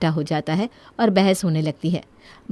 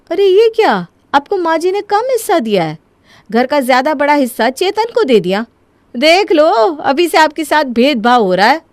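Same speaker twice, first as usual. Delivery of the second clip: higher-pitched indignant complaint; indignant protest; 5 semitones higher than usual. Acted. Wife provoking husband over unfair share, indignant protest. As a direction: stir him up with outraged disbelief.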